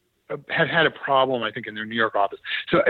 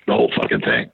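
a lightly voiced gruff reply, much lower; blunt star; faster than usual. The show's star bluntly answers the producer in a much lower voice. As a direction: drop way down for a flat, blunt retort.

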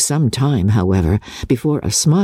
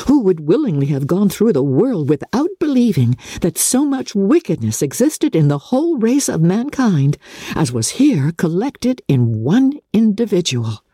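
usual speaking voice

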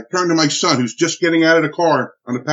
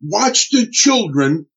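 raised, higher, slowed urgent call; retold yell; 7 semitones higher, slower than usual. Husband to wife's friend, a raised urgent call. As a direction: call out to her in a hurry. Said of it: "I yelled to her".

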